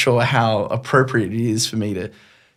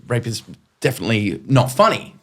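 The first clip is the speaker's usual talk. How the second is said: ordinary talk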